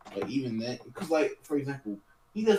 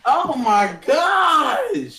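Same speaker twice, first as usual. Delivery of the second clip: a real, much higher, louder and slow outraged shout; shocked shout; 10 dB louder, much slower than usual. Real, not acted. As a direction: yell out in embarrassed disbelief. Friend announces toilet flush.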